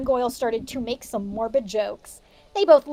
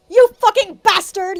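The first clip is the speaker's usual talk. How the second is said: loud mock shout at a rival; hammed-up yell; 7 semitones higher, 7 dB louder than usual. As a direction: yell it like a furious teen. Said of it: "yelled Draco at Vampire".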